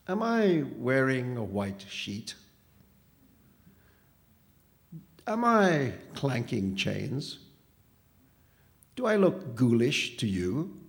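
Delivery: slow, drawn-out dismissive questions. A narrator's reading. Phantom answers boy who took him for a ghost, dismissive. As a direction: brush the idea off with slow, mocking questions. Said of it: "demanded the phantom dismissively".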